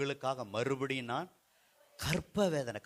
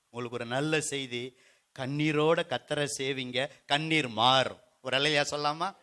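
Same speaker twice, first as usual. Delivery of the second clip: usual speaking voice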